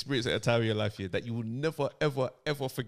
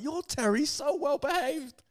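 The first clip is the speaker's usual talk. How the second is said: high, slow sugary mum voice; chatty mum; 11 semitones higher, slower than usual. Retold or imitated. Chatty mum praising son to other mum. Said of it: "She's like".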